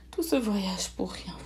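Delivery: understated letdown; a touch of dejection; disappointed. A narrator's reading. Friends before the empty chest, mildly disappointed. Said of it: "Déçus".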